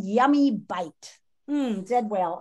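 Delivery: normal talking voice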